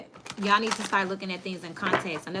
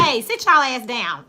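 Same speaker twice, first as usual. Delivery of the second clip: stern teacher command; 3 semitones higher, 9 dB louder than usual. Retold or imitated. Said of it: "When I said".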